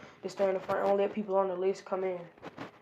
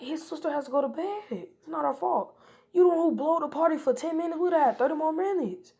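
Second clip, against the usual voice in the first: whiny protest; 8 semitones higher than usual. Retold or imitated. Sakura and Sasuke argue back angrily.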